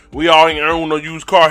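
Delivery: normal talking voice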